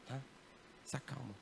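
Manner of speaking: gently soft, settling the crowd; calming, soft. Real, not acted. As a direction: hush them down gently. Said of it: "orar baixinho".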